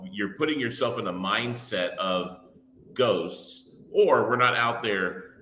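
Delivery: normal talking voice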